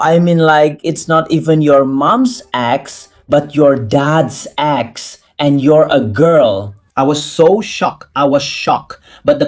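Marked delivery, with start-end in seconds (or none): 0.8-5.2 drawn out in disbelief; slow, emphatic. Real, not acted. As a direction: spell out the twist slowly. Stressing the absurd twist.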